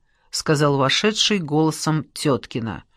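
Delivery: plain reading voice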